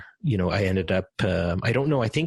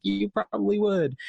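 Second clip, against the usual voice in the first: mock reply; 12 semitones higher than usual. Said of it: "And she's like".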